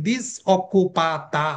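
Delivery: ডিসঅকুপাতা stretched out slowly, stress on the final a; showing the feminine ending of ডিসঅকুপাতা; slow model word. Shown as a demonstration. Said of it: "কোনো মেয়ে হইলে কিন্তু কি বলতে হবে?".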